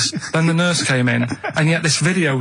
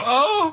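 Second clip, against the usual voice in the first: fainting gasp. Retold or imitated. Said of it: "You just heard her go".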